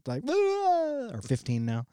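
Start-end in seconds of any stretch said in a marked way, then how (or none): 0.3-1.1 high, softer imitated wail; teenage daughter's meltdown noise, mimicked; mock teen freak-out wail. Daughter bugging out at her dad, mock high wail. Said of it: "Jalen bugs out 'cause she's fourteen".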